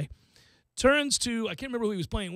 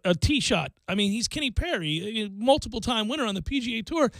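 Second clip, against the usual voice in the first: ordinary delivery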